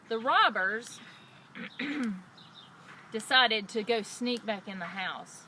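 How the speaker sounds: usual speaking voice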